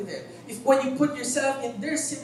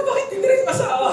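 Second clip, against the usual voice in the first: much higher, louder, fast and laughing; laughing question; 11 semitones higher, 10 dB louder, much faster than usual. Real, not acted. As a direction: blurt it out cracking up. Jokes about Job's wife, laughing. (tumatawang tanong, mabilis at mataas)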